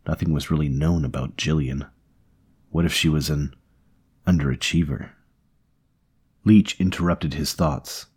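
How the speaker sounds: plain reading voice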